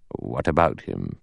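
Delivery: low, soft counter-question; unmoved judge. A narrator's reading. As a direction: return the question low and unruffled. Judge answers, unblinking.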